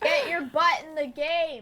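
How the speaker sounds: loud call to another room; yelling across the house. Real, not acted. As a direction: shout it out through the house. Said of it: "you can just yell across the house".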